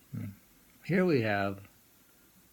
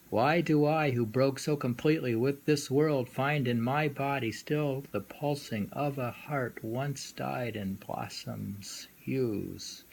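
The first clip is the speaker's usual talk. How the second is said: louder, raised start, slowing at the end; poem reading; 3 semitones higher, 3 dB louder than usual. Acted. Lecturer reading Saigyo's poem to the students, lyrical. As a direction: read it with lyrical feeling.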